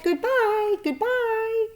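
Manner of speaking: put-on high, thin baby-spider voice; tiny spider chorus. Baby spiders bidding Wilbur goodbye, high and tiny. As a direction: squeak it like a swarm of tiny spiders.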